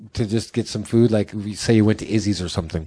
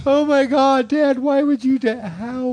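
much higher, mock tearful wail; imitating crying daughter; 13 semitones higher, slower than usual. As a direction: act out the daughter bursting into tears, pitched way up. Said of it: "literally burst into tears".

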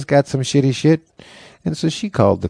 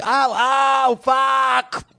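very high imitated pain shriek; Kinison-like mock screaming; 14 semitones higher, much slower than usual. Brother in pain interrupting, high mock scream. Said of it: "you're Sam Kinison".

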